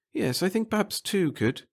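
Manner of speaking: high, nervous little official; a fussy, timid bureaucrat. Acted. Pettigill musing.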